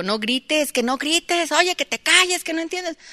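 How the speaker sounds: high, shrill-free mock scolding shout, rising as it goes; mother yelling. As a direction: play the mother yelling at her child to stop yelling. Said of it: "¿Y para qué estás gritando tú".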